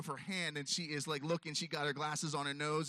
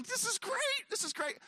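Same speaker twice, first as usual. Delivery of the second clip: much higher, gleeful repeat; giddy delight. Retold or imitated. Riding through the car wash, giddy. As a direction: squeal with joy at the car wash.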